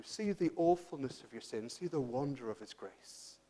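soft, low, reverent preaching; hushed closing appeal. Real, not acted. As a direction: bring them gently down into worship.

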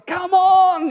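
loud, high "come on" to yourself; self-urging shout. Retold or imitated. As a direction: spur yourself on like a frustrated player. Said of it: "he says to himself".

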